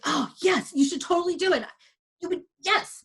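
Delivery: imitating an overeager husband; eager encouragement. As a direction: push her on, all enthusiasm. Husband eagerly urging her on.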